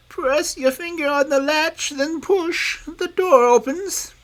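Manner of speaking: put-on wolf faking grandmother to the girl; disguised wolf calling sweetly through the door; wolf as granny.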